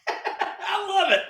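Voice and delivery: high laugh spilling into the words; cracking up with glee, slowly; laughing delight. Real, not acted. Laughing at his co-host's rant, delighted laughter.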